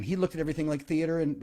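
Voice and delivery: ordinary talk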